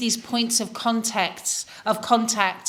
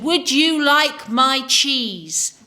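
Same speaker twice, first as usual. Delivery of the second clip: slow, loud, over-enunciated offer; condescending imitation; 4 semitones higher, 4 dB louder, much slower than usual. Seatmate offering cheese to a presumed foreigner, slow and patronizing. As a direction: talk down slowly, raised pitch and drawn-out words.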